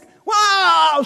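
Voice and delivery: a big loud, high joyful wow; excited wow. Acted. Believer thanking God after a prayer is answered, overjoyed wow. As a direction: burst out with delight. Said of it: "încep să sar".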